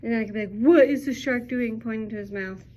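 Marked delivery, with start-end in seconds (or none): 0.6-1.8 put-on bright, loud question to kids; loud teacher question. Shown as a demonstration. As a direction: call it out to the class. Teacher asking class a question.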